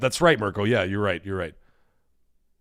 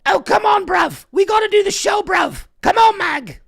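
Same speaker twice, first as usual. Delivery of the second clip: high, loud British mate shouting; hammed-up screechy pleading; Gaz impression; 4 dB louder, slower than usual. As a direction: pester at a high-pitched yell. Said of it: "I love making fun of Gaz".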